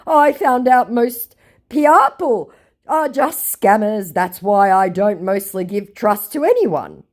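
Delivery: high, loud mock sincerity, slow start; hammed-up, declaimed explanation; earnest scammer. Scammer says he trusts nobody, high mock-earnest voice. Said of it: "said my scammer".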